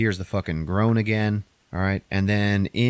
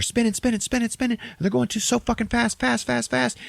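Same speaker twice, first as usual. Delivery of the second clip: much higher, racing repetition; high pitched. Retold or imitated. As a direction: sing it up like spinning machines. Mimicking spinning turbines.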